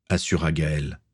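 usual speaking voice

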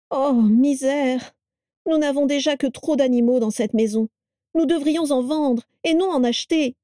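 high-pitched daughter-in-law's complaint; exasperated lament. A narrator's reading. Carla complains to Vera of too many animals, lamenting. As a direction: wail mildly, pitched high throughout.

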